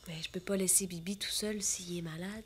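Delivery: hushed whisper, don't wake him. Acted. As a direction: breathe it softly over a sleeper. Bibi asleep, she whispers.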